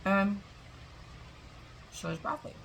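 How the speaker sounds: talking with a mouthful; chewing while speaking; mouth full. Real, not acted. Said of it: "Sorry for talking with my mouth full".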